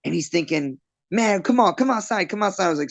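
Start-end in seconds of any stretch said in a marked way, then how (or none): 1.1-2.7 higher-pitched mock taunt to fight; imitated challenge. Ejected bar customer daring the bouncer to come outside, mock challenging. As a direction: egg him on in a retold customer's raised voice.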